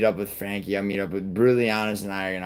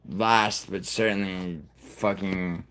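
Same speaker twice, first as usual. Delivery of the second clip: normal talking voice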